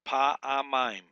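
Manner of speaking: Pa, A, Maim said as three separate loud beats; syllable by syllable. Shown as a demonstration. Teacher breaking a Hebrew word into three syllables. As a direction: split Pa, A, Maim apart. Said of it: "So it's just three syllables".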